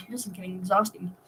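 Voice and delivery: ordinary delivery, nothing marked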